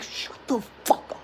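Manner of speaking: hissed, breathy whisper of an exhausted mother; harsh whisper. Retold or imitated. Exhausted new mother hisses at her husband, harsh whisper. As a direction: mom hisses at the dad to shut up. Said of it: "She's like".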